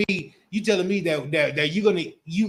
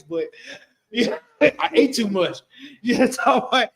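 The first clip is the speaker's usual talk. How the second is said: laughing; 7 semitones higher, 4 dB louder than usual. Retold or imitated. Sister's confession retold, laughing.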